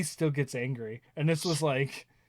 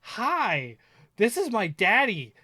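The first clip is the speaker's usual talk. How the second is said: put-on higher, proud little-girl voice; child voice; 8 semitones higher, 6 dB louder, slower than usual. Dad imitating his daughter introducing him, higher. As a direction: announce it like a proud kid.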